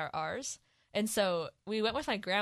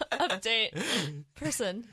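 slow, laughing through the words; real giggling, drawn out; 3 semitones higher, much slower than usual. Real, not acted. Breaks up laughing mid-introduction.

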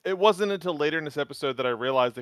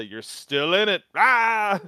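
mock Polnareff line; 6 semitones higher, 4 dB louder, much slower than usual. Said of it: "Polnareff to say".

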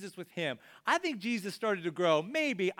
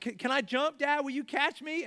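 put-on high, hopeful little-kid asking; nervous child; 7 semitones higher than usual. Child at the pool edge asking dad, nervous and excited. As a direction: ask Dad to catch you, nervous. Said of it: "you're like".

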